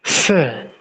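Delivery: teacher's S demo; Russian S. Shown as a demonstration. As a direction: say sir with a clear hissed S. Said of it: "The sounding of the letter is sir".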